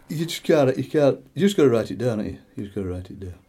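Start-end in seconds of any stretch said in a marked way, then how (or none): none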